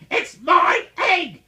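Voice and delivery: very high, loud, slow shout; angry outburst. A narrator's reading. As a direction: spit it out furiously. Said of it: "she spurted".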